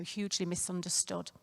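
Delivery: ordinary delivery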